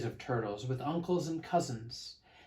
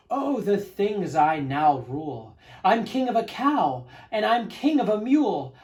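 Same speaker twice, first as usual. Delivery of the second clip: loud, higher, swaggering boast; gloating king; 5 semitones higher, 6 dB louder than usual. Acted. Yertle listing all he rules, loud gloating king. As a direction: revel in your own greatness. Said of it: "Yertle boasts from the stack".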